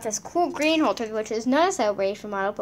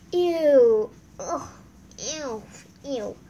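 disgusted ew; 3 semitones higher, 5 dB louder, much slower than usual. Real, not acted. Reacting to poop.

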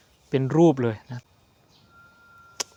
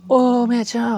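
surprised exclamation; 9 semitones higher, 4 dB louder than usual. Real, not acted. Ghost sighting.

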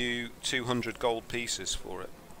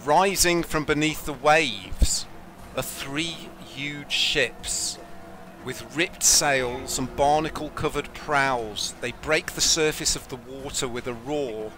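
dramatic narration; 3 semitones higher, 5 dB louder than usual. Acted. GM narrates the ships rising.

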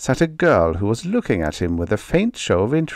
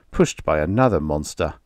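plain reading voice

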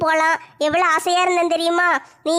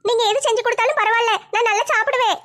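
put-on higher, childlike younger sister complaining; whiny kid sister, voice raised high; 5 semitones higher than usual. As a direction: pitch up as the weary little sister.

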